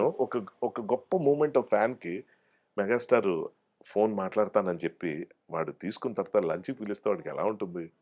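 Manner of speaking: ordinary talk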